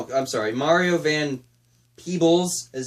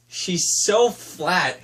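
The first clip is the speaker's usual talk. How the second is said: ordinary talk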